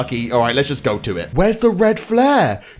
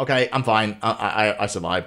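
low, soft, stammered recovery; shaken relief; 9 semitones lower, 6 dB quieter than usual. Real, not acted. Recovering after car scare.

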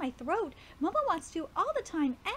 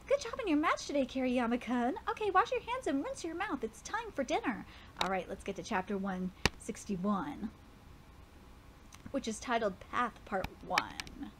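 usual speaking voice

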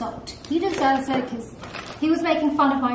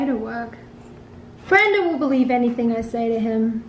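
normal talking voice

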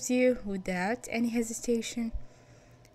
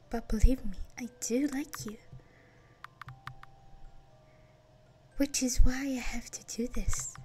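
whisper; 4 semitones higher, 3 dB louder than usual. Acted. Villain whispers creepy confession.

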